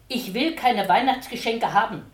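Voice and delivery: loud, hard refusal; a stubborn boy's hard no. Acted. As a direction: push it away, hard and loud. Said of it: "mit harter Stimme".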